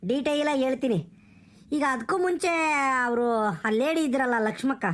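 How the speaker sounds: usual speaking voice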